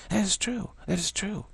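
high agreement. Real, not acted.

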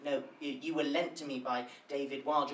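usual speaking voice

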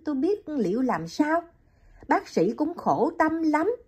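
Liễu explains the doctor's hardship to Hiền, high and gentle; young woman, sympathetic. Acted.

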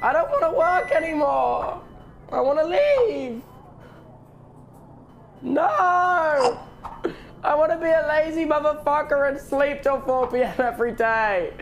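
high-pitched, dragged-out whining; hammed-up high whine of protest; whiny mock tantrum. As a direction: wail like you're trapped at work forever. Mock tantrum, stuck at work.

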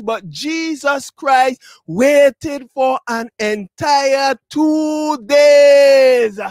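slow, drawn-out preacher's cry; emphatic preaching. Real, not acted. Preacher stressing the wait to the congregation, slow emphatic build. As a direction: stretch it out, building to a loud peak.

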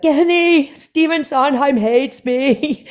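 high, teary wail of despair; wailing down the phone to her husband Kenny, mock crying. Calling her husband late at night, half-mock crying high. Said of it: "just crying and crying".

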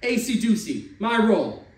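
loud, high gleeful yell; Louis yelling over the craps. Acted. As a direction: crow it across the game. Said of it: "Louis started yelling".

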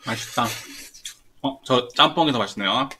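talking while eating; chewing food. Real, not acted. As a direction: mouth partly full. Seen 0: Both men eat and chew throughout; unclear which speaks.

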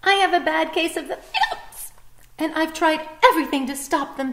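a bird desperate to stop hiccups; frazzled bird. Acted.

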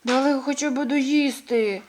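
a mole grumbling that they wouldn't let him finish eating. A narrator's reading. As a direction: moan about the lost meal. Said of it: "нарікав Хрумт".

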